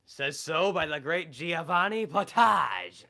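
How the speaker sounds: booming, high, slow theatrical voice; Giovanni Potage impression. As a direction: announce it like a grandiose chef. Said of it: "my best Giovanni impression".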